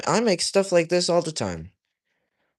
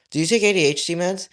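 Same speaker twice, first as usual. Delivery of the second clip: ordinary delivery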